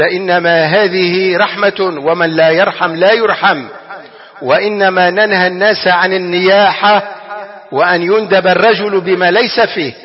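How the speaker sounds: slow, weighty hadith recital, stretching every word; solemn recitation. Acted. Preacher to his congregation, quoting the Prophet, slow and solemn. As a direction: intone the Prophet's words slowly and gravely.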